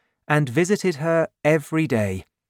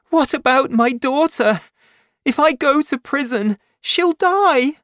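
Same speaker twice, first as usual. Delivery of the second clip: much higher and louder, frantic plea; desperate pleading; 14 semitones higher, 6 dB louder than usual. Acted. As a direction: beg as if her child will die. Fantine pleads before crying.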